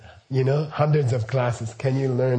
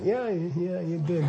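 wry agreement; 6 semitones higher, 4 dB quieter than usual. Retold or imitated. His own reply, quoted.

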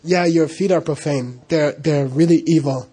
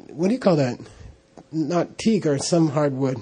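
ordinary delivery

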